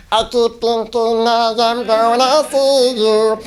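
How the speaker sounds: slow sung line, strained and squeaky high; chipmunk voice. Shown as a demonstration. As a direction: sing it like a chipmunk. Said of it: "I sound like this".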